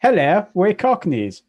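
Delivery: slow hammed-up Cockney greeting; a light stab at a Cockney accent; mock Cockney. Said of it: "a Cockney accent".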